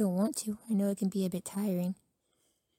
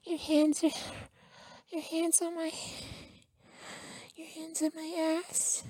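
voice goes higher; 8 semitones higher, 5 dB quieter than usual. Acted. Boyfriend, surprised by a touch, much higher. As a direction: pitch shoots up in startled delight. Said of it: "my voice goes higher when you touch me".

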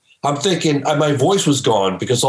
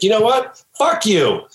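raised, clipped comeback, a little higher; retold angry retort; 7 semitones higher than usual. Kim curses at the financial backer, mock anger. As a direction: throw the insult back sharply. Kim Arnold cursing at Larry.

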